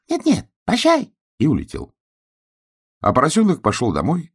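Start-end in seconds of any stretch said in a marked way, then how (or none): 0.0-1.1 little duck voice. Acted.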